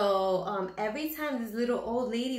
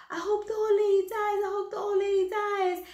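high, louder parrot chant; squawking parrot; 11 semitones higher, 4 dB louder than usual. Acted. The parrot taunting the old lady, high squawk. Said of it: "the parrot was like".

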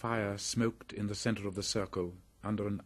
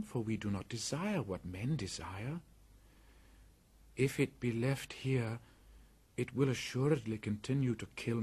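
put-on young boy voice; 5 semitones higher than usual. Mowgli argues about the ankus.

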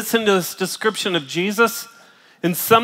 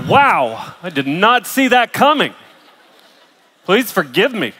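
mock awestruck; 2 semitones higher, 7 dB louder than usual. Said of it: "you're just like".

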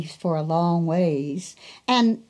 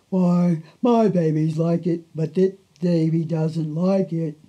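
slow cow voice; 2 semitones lower than usual. Acted. Cow chewing her cud offers to sing, slow and drawn out. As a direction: stretch every word like a lazy cow. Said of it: "with her slow voice".

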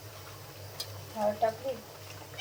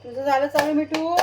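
raised, higher voice calling across the room; calling out to pets; 5 semitones higher, 4 dB louder than usual. Real, not acted. Mother calls to her cat and parrot, raised and high. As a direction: call the pets from the stove.

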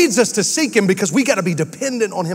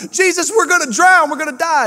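much higher panicked cry, rushing; frantic cry; 9 semitones higher than usual. Retold or imitated. Disciples yelling to Jesus that they'll drown, high and frantic. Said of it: "disciples frantic in storm".

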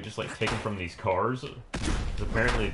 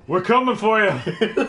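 menacing taunt; 10 semitones higher, 7 dB louder than usual. Pretend. Playful menacing call.

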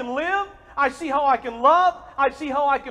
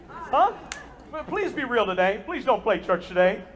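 normal talking voice

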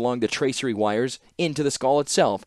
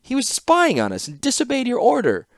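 much higher, protesting; indignant; 14 semitones higher, 3 dB louder than usual. A narrator's reading. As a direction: defend herself, offended. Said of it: "looked indignant".

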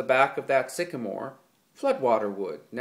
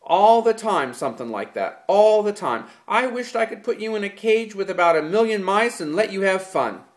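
loud, high furious scolding; angry tirade; 7 semitones higher, 7 dB louder than usual. A narrator's reading. George to Lenny about the girl incident, furious. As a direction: let the anger spill out.

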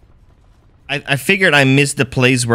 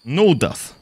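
put-on Russian accent while gaming. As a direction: play the comrade with a hammy Russian accent. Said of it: "Russian accent, comrade".

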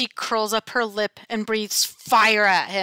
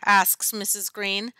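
plain reading voice

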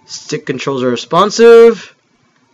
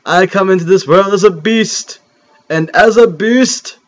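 grandiose beast vow; 3 semitones higher than usual. Acted. Gamer voicing his creature's vow to rule, grandiose. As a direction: vow it like a hammy hero. Creature vows to become king.

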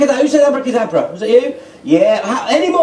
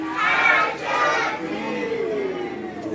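magic word; 12 semitones higher, 10 dB quieter, much slower than usual. Acted. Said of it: "as loud as you can".

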